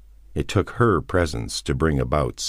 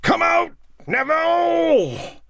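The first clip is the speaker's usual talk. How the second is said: very high, drawn-out taunting call; shout; 4 dB louder, slower than usual. A narrator's reading. Cortman calls Neville out of the house, shouting. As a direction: yell it long, calling someone out. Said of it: "he heard Cortman shout".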